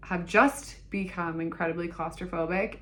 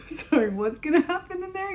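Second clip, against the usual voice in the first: high, alarmed retold question; mock alarm; 11 semitones higher, 4 dB louder than usual. Patient asking doctor what happens in the chamber, mock alarm. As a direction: squeak it out as a worried patient.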